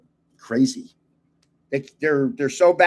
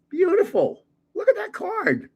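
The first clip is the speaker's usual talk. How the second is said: excited. Real, not acted. Admiring a new card.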